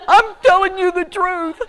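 high, loud comic insistence; high, loud pleading yell; mock wailing protest. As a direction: cry out your innocence, hammed up.

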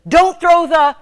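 loud, high cut-off yell; husband yelling to stop a throw; urgent shouted warning. Retold or imitated. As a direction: shout a panicked stop mid-sentence.

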